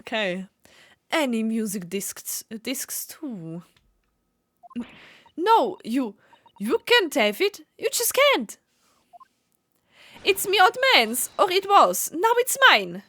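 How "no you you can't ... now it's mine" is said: high, loud stubborn urchin; defiant kid voice. Acted. Urchin refuses to hand over the coat, high and loud. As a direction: clutch it and refuse.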